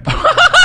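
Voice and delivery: high-pitched burst of laughter. Real, not acted. Comic laughs telling podcast hosts the story, high burst of laughter. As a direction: crack up loudly.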